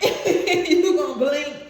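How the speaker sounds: breaks into a laugh, then stretches it out slowly; laugh, then land it slow and big; laughing emphasis. Real, not acted. Laughing emphatic repeat of prediction.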